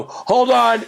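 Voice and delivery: high, loud call to the others. Retold or imitated. Ten-year-old calling to friends at camp, loud call. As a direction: shout it to stop the shooting.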